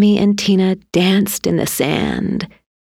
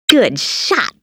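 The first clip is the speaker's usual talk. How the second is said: mild raised-pitch cheer; shout; 5 semitones higher than usual. A narrator's reading. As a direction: call it out as a short, restrained shout. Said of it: "Tina shouted".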